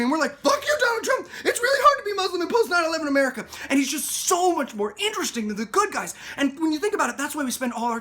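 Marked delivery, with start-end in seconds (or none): 0.4-3.5 high, loud, fast indignant retort; mock outrage. Outraged public answers the villain candidate, high mock shouting. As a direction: shout back in a rush of hammed-up outrage.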